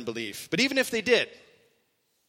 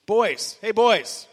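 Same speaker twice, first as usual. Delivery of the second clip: loud, casual hail to the lads, higher; familiar call; 5 semitones higher, 6 dB louder, much slower than usual. Retold or imitated. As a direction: call out casually to old friends. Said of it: "It's very familiar".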